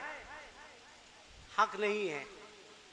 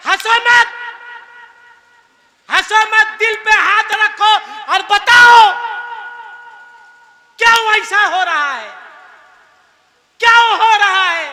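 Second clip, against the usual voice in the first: much higher, much louder rebuke; scolding shout; 10 semitones higher, 14 dB louder than usual. Real, not acted. Preacher rebukes the laughing congregation, loud angry shout.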